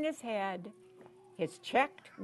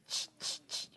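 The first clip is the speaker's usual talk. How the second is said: soft voiceless "ch" monkey noises; monkey chatter; 9 dB quieter than usual. A narrator's reading. Storyteller mimicking monkeys for children, chittering.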